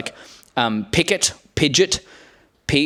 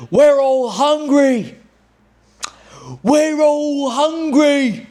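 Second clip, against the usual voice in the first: much higher, very slow, louder rallying voice; Jod impression; 10 semitones higher, 3 dB louder, much slower than usual. Jod rallying the pirates, slow, high and overblown. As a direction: stretch it out like a grand rally cry. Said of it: "I can do impressions".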